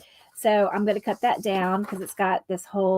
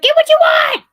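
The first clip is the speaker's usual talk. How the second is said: mom's shout; 10 dB louder than usual. Retold or imitated. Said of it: "She's like".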